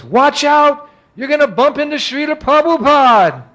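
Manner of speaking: loud, high-pitched urgent yell; alarmed warning shout. Retold or imitated. Someone warns him walking backwards into Prabhupada, alarmed shout. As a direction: shout a sudden warning, voice shooting up.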